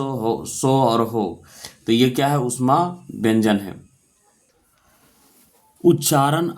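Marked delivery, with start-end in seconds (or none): 0.0-1.4 हो, सो and हो stretched out very slowly; teacher slowly modelling the sibilant sounds; slow sound drill. Shown as a demonstration. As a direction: say each sound slowly so the class can copy it. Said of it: "ये चार वर्ण होते हैं".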